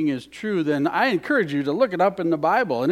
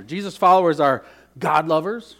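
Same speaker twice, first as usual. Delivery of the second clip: ordinary delivery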